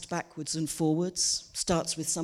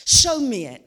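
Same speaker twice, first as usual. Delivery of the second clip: higher, louder, punch the word show; insistent repeat; 6 semitones higher, 9 dB louder than usual. Retold or imitated. Friend repeating her demand to see it, louder and pushier. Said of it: "I asked a friend of mine".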